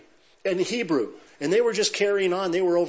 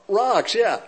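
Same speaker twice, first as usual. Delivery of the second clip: laughing; 5 semitones higher, 5 dB louder than usual. Real, not acted. Crowd joke about Israeli rocks.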